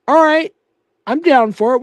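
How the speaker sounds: high, loud burst of bravado; fired-up acceptance. Real, not acted. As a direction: jump at the challenge, pumped. Accepting a challenge from chat.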